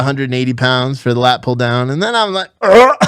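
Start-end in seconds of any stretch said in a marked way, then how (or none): 2.6-3.0 loud, higher-pitched retch imitation; mock gagging. Lifter hit by a stench, mock gagging. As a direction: act out a gag. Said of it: "I start fucking gagging".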